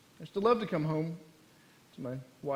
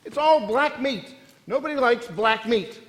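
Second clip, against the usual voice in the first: indignant; 4 semitones higher, 7 dB louder than usual. Acted. Felix complains about burnt dinner.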